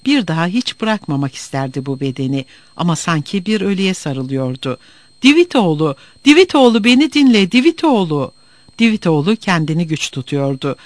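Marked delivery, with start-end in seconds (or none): 5.2-8.3 high, loud pleading calls of his name; calling his name in rising desperation; desperate pleading. A narrator's reading. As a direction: beg him to listen, voice climbing. Pleading desperately to unresponsive lover.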